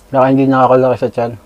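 talking with mouth full; mumbling between bites; chewing food. Real, not acted. Seen 3: Bites and chews meat at the mouth throughout the labelled words.

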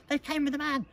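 put-on high, loud child's protest; urgent insistence. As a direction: push the warning through, high and loud. Child insists over parents' interruptions.

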